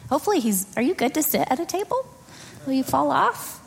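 sweet voice to the little ones. Real, not acted. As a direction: coax the toddlers kindly. Seating small children at the table.

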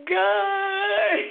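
high, loud cry of God's name, near tears; anguished cry to God. Retold or imitated. Crying in bed, she calls out to God in anguish. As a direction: call out to God in agony.

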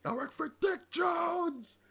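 high, slow, desperate shout; panicked thug shout. Retold or imitated. Panicked thug being thrown.

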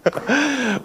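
high bursts of laughter; laughing. Real, not acted. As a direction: laugh helplessly. Laughing through explanation.

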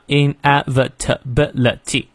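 "inevitability" split into seven separate beats; syllable by syllable. Shown as a demonstration. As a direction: pause between every syllable of "inevitability". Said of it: "感受一下". (“inevitability”逐个音节分开念)

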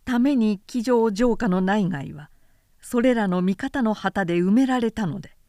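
ordinary delivery

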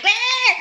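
short high mock cry of pain; imitating a little brother's yelp. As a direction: yelp it like a hit kid. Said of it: "He's like".

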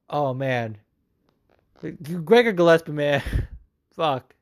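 ordinary talk, nothing marked

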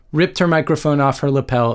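usual speaking voice

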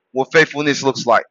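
usual speaking voice

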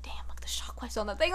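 whispered, quiet mumble to himself; soft whispered admiring mumble; mumbling. Retold or imitated. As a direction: mutter it under your breath. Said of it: "mumbling it to himself".

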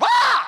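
sudden high, loud scream of alarm; startled yell. Retold or imitated. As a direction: let out a sharp, piercing yell as the van jolts. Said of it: "We yelled".

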